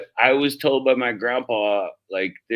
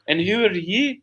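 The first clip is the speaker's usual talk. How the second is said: lightly voiced old Irishman, higher; 8 semitones higher than usual. Retelling the old Irishman questioning the Scot, lightly voiced. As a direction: ask it like a cheeky old Irish local. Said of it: "Oh, that was good".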